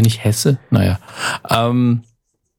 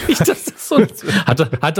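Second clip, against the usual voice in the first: laughing; 5 semitones higher than usual. Real, not acted. Laughs while echoing partner.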